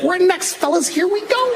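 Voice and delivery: very high, hyped-up cartoon voice; rally the fellas at the top of your range; squealing mock excitement; excited sperm. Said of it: "Your sperm is just excited".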